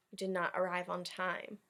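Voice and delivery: ordinary delivery, nothing marked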